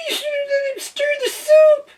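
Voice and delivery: very high, crying squirrel voice; sniffling squirrel. A narrator's reading. Squirrel crying over the soup, sniffling. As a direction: sniff through tears. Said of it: "sniffed the squirrel".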